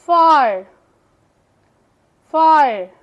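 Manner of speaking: Fire said loud and firm; word demo. Shown as a demonstration. Teacher to students, modelling fire loudly. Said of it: "आर control sound".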